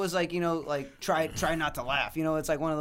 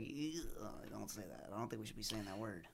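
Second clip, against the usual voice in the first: hushed reproach; 5 semitones lower, 15 dB quieter than usual. Retold or imitated. Retold scolding of little cousin.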